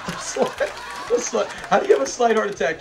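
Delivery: giggling, can't believe the line; cracking up at a silly line; laughing in disbelief. Real, not acted. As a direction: laugh through the words, incredulous.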